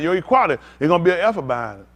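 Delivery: ordinary talk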